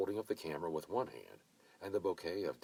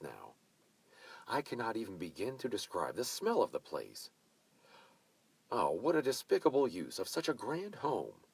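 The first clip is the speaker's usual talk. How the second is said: indignant hostess; 4 semitones higher, 6 dB louder than usual. A narrator's reading. Mavis ranting about old house.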